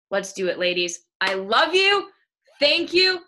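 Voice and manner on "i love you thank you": high and loud gushing thanks; excited. Real, not acted. Coach thanking her team at the close, gushing. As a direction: burst out with joy.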